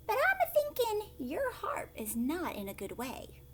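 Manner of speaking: high, impish little leprechaun. Acted. Leprechaun telling the old harper his harp is bad, high impish voice. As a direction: pitch it up like a sly little man. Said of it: "said the leprechaun".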